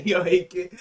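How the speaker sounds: loud, high laugh into words; real helpless laughter; laughing hard. Real, not acted. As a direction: burst out laughing, then call out through it. Laughing at the clip.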